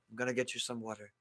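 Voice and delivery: put-on hushed Italian-accented waiter; a light imitation of an Italian accent. Waiter seating the guests, soft Italian accent. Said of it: "all in, like, an Italian accent".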